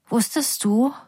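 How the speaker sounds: a question that cracks and fades; voice breaking. A narrator's reading. Daughter asks mother about the murder, voice breaking. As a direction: let the voice give way on it. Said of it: "Meine Stimme bricht weg".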